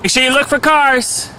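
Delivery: high, loud warning call. Real, not acted. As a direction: call after her across the lot. Dad calls to child outside.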